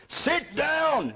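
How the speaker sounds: high-pitched retold yell of an angry judge. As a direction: yell it high, as a judge losing his temper. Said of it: "basically yelling".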